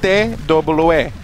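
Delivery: playful mock Spanish accent; put-on Spanish accent. Caster introducing himself in a hammed-up Spanish accent. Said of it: "What's the accent for?".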